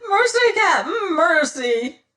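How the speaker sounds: high, loud terrified squealing plea; pitiful squeal. A narrator's reading. Spraggins begs the captain for mercy in a pitiful squeal. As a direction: beg for your life in a squeal. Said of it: "cried Spraggins, his high-pitched voice rising to a pitiful squeal".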